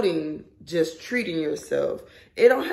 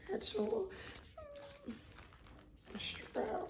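hushed, unvoiced whisper of the item names; breathy whisper; 19 dB quieter, slower than usual. Real, not acted. Unboxing the bag, whispering its parts. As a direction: breathe the words out like a secret.

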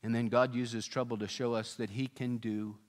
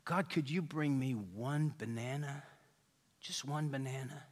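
hushed plea; 7 dB quieter than usual. Retold or imitated. Prisoner begging God quietly.

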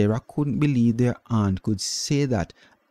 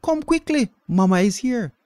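excited shout; 13 semitones higher than usual. A narrator's reading. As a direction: hurry them over with joy. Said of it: "she shouted".

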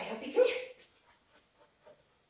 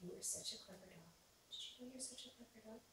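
soft praise; 14 dB quieter than usual. Real, not acted. Softly praising the dog.

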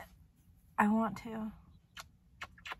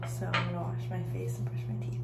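quick morning whisper; faster than usual. Real, not acted. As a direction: whisper it fast. Morning routine, still whispering.

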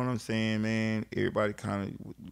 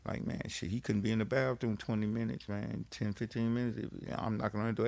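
usual speaking voice

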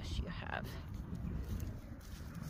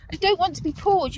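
loud scold; 8 semitones higher, 8 dB louder than usual. Real, not acted. Scolding muddy dog.

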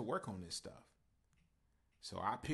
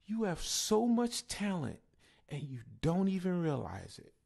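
teacher's praise; 9 semitones higher, 8 dB louder than usual. Retold or imitated.

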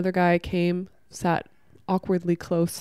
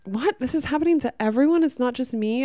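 higher-pitched incredulous outburst; fiery disbelief; 6 semitones higher, faster than usual. Retold or imitated. Women realizing it happens to everyone, fiery and incredulous. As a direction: imitate women lighting up in astonishment. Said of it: "they just get, like, kind of fiery".

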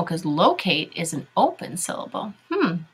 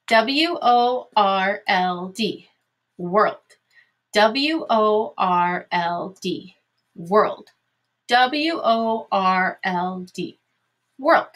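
measured classroom spelling chant; slow, separated letters for pupils to follow; slow spelling drill; much slower than usual. Shown as a demonstration. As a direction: spell it out letter by letter, then say the word. Teacher spells words aloud three times.

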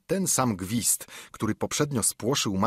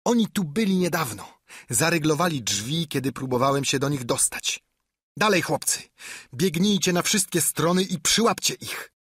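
ordering angrily; 5 semitones higher than usual. A narrator's reading. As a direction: drive the men on. Blind Pew ordering search. (ślepiec ponagla zbójców)

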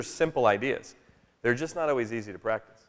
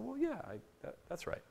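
hushed, halting near-whisper with trailing words; hesitant hush; 12 semitones higher, 15 dB quieter than usual. Retold or imitated. A dad caught off guard by his daughter's question, halting hush. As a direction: half-whisper it, unsure how to answer.

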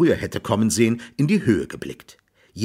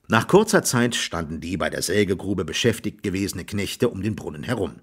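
ordinary delivery

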